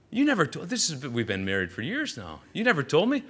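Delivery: playful outrage at a spouse's revelation; retold indignant disbelief. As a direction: protest as if just finding out. Retold exchange with wife, shocked.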